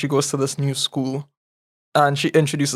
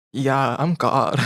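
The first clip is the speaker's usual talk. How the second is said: fake God voice; slower than usual. Ghost pretends to be God.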